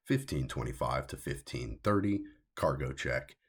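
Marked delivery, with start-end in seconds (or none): none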